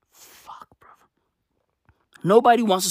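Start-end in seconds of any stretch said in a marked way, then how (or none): 0.1-0.9 a hushed, breathless fuck; whispered curse. Real, not acted. Comedian cursing the tongue twister, whispered. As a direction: mutter it under your breath. Frustrated aside.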